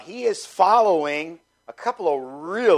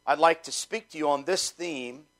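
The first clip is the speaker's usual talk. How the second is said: ordinary delivery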